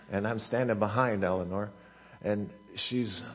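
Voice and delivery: normal talking voice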